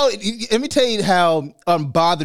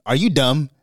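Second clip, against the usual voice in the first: usual speaking voice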